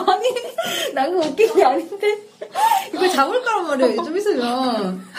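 giggling while explaining; can't stop laughing. Real, not acted. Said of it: "웃는 게 아니에요".

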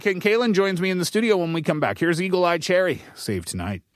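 usual speaking voice

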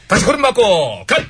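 drill command. Acted.

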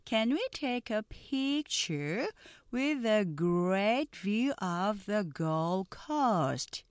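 very slow, careful English dialogue; slow pronunciation drill; slow model read. Shown as a demonstration. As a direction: read each line slowly for learners to repeat. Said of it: "느린 속도 발음 듣고 천천히".

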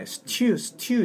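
"stew" said quickly as the learner's mistaken version; common mispronunciation of "stew". Shown as a demonstration. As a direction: show the short, careless "stew" to avoid. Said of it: "이렇게 발음하거든요".

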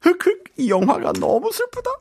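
very high, fast hammed-up sobbing; mock sobbing. Teacher performs a sad-movie line for students, mock sobbing. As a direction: sob theatrically over a sad movie. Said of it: "win an Oscar right there".

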